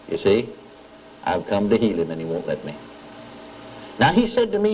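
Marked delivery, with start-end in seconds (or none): 0.1-2.7 lower, sorrowful voice of Jesus; sad tone. Retold or imitated. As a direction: let the sadness weigh on the words, lower. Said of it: "such a tone of sadness in his voice".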